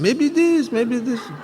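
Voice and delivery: high, sing-song Yiddish shrug; comic Yiddish-accented old man. Acted. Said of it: "He has a little bit of a Yiddish accent".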